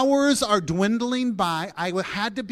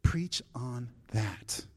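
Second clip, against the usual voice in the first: lower, very slow voice of God; 6 semitones lower, 5 dB quieter, slower than usual. Retold or imitated. Pastor voicing God's reply to his prayer, slow and low. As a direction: hand down the answer slowly and low. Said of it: "He says".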